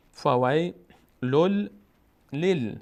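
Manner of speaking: drawn-out teaching recitation of فویلل لیل; lam joined to lam. Shown as a demonstration. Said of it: "دوباره لام میچسبه به لام".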